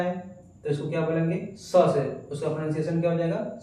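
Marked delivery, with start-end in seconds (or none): none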